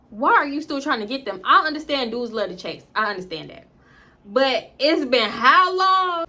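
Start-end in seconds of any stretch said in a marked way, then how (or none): 4.3-6.3 exasperated. Real, not acted. Exasperated rhetorical question.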